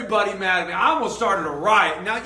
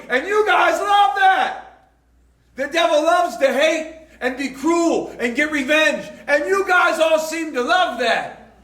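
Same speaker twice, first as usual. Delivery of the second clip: forceful preaching; 4 semitones higher than usual. Acted. Pastor warning the congregation about loving sin, preaching hard. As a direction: hammer the point home from the pulpit.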